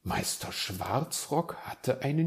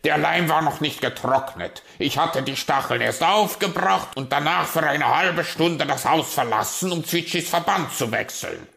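loud, raised-pitch raven grievance; cross raven; 6 semitones higher, 10 dB louder than usual. Acted. Raven explaining the glue was still wet, cross.

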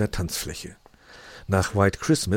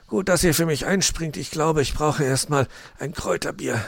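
higher changed fox voice, relieved; weary Mr Fox; 8 semitones higher than usual. Acted. Mr Fox thanking the kids before going to the bar, relieved. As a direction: sigh out your thanks, worn out.

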